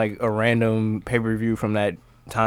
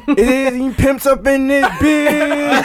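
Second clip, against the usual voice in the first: high, drawn-out mock pimp catchphrase; The Godfather; 12 semitones higher, much slower than usual. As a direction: crow it slowly like a wrestler hyping his entrance. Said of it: "he would be like".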